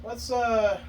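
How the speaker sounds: ordinary delivery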